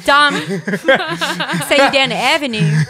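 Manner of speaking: put-on quick, laughing Baltimore accent. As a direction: do a hammed-up Baltimore local. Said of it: "my favorite accent to do".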